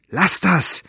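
high, loud protest. A narrator's reading. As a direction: fight off her grip with a cry. Girl resisting the woman's grip. (lauter, hoher Protest)

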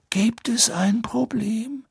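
put-on high, slow, whispery old headmaster; mildly curious Dumbledore. Amused, curious Dumbledore appears.

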